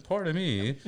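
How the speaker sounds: imitating his son being fancy; posh little boy. Dad imitating his son's prim "pardon me". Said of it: "hold his pinky up and".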